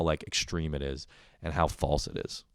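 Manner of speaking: ordinary talk, nothing marked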